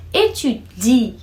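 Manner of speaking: Étudier drawn out slowly, leaning on the final i; étudier, pulled on the i. Shown as a demonstration. Teacher drawing out Étudier for students, leaning on the i. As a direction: stretch Étudier and land on the i. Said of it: "que puxa aí no I".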